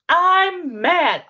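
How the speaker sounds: put-on high, loud little-kid "I'm mad."; child's answer. Teacher mimics the child declaring it, high and loud. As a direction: blurt it like a cross small child.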